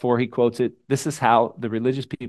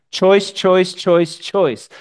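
choice hammered, louder and higher each time; Choice, choice, said loud and punchy; emphatic repetition; 7 semitones higher, 5 dB louder, much slower than usual. Shown as a demonstration. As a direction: pound the word choice out. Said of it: "repeated emphatic word".

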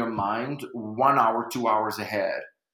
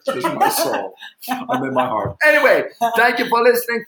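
hammed-up high voice; 11 semitones higher, 7 dB louder than usual. Hammed-up sentimental sign-off.

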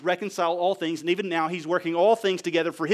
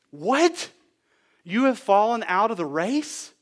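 incredulous, higher-pitched questioning; acted disbelief; 6 semitones higher, 5 dB louder, slower than usual. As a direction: react as if you can't believe they quit. Acts disbelief at friends quitting.

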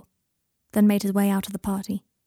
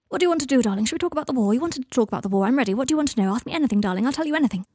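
higher-pitched, gushing run-on; manic, eager patter; 4 semitones higher, much faster than usual. Acted. Draco badgering Michael to talk, manic and gushing. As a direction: babble eagerly, pitch swooping up. Manic babbling to boyfriend at party.

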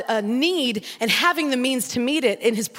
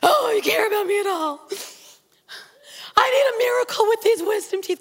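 mock crying plea; 8 semitones higher than usual. Hammed-up prayer repeated.

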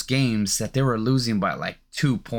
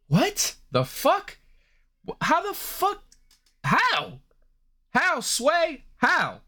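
high-pitched exasperated how the fuck; pleading in disbelief, a real rant; incredulous disbelief; 14 semitones higher, slower than usual. Real, not acted. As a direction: squeak out your disbelief, pitch jumping up. Disbelief at Luka getting fatter.

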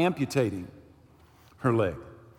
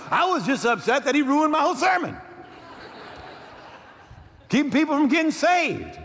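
mock indignation; 8 semitones higher than usual. Real, not acted. Pastor joking to the congregation about his ruined sermon, mock indignant. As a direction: play up the grievance with a laugh. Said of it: "mock complaint about ruined sermon".

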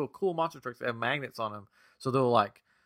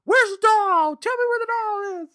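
put-on much higher and louder girl voice demanding; daughter interrogating; 8 dB louder than usual. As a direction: squeal it out like the little sister joining the grilling. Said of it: "my daughter gets in on it".

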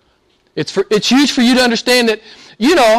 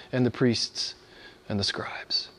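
softer and lower into a whisper; fading to whisper; 8 semitones lower, 13 dB quieter than usual. Real, not acted. Preacher trailing off into a whisper to congregation. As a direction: let the list die away.

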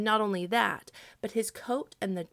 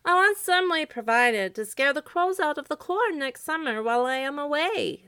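high, louder scarecrow voice; 8 semitones higher, 5 dB louder than usual. A narrator's reading. Scarecrow sets terms for Santa, high voice. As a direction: pitch it high and firm.

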